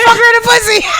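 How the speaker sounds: high, loud laughing yell; yelled prank catchphrase with a laugh; mock yell. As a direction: belt the catchphrase through a laugh. Quoting a prank trend.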